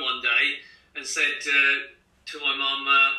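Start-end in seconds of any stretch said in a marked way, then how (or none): none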